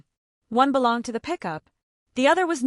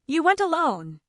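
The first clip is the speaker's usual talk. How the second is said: higher, mildly sharp question; sharpened voice; 7 semitones higher than usual. A narrator's reading. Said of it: "His voice sharpened".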